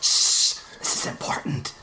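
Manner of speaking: whispered hush, unvoiced; shushing whisper. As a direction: hiss it like a shushing moviegoer. Shushing audience at premiere.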